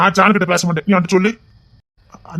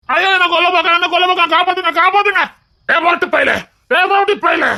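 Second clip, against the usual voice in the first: hammed-up cry for help; 8 semitones higher than usual. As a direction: wail for rescue, then holler insults. Cries for help, abuses those feeding him.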